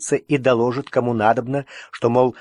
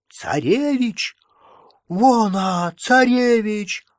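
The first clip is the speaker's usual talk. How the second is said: high, slow excited calling out; excited crowd call; 8 semitones higher, much slower than usual. A narrator's reading. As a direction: call out in amazement.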